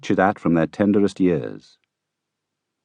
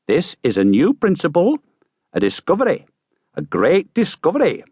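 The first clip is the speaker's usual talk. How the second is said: high, proud announcement; pleased gentleman; 11 semitones higher, 4 dB louder than usual. Acted. Gentleman proudly announcing his principle, high and pleased. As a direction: crow over the discovery.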